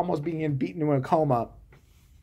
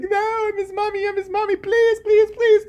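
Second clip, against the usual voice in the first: very high, whiny begging; mock pleading; 4 dB louder than usual. Mocking the heroine begging for her baby, high and whiny. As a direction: beg in a high whine.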